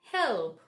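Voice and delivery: help with the tongue rolled on the L; careful demonstration of help; dark L. Shown as a demonstration. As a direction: stretch the dark L in help, falling. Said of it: "roll your tongue a little bit".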